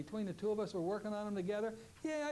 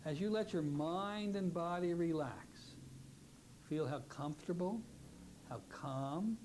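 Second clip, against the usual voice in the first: guided relaxation; 2 semitones lower than usual. Shown as a demonstration. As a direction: ease them down into calm. Leads closing relaxation.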